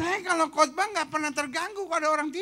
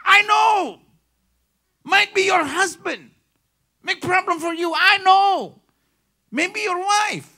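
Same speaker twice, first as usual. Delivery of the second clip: loud preaching; 6 semitones higher, 10 dB louder, slower than usual. Acted. Preacher shouting empathy.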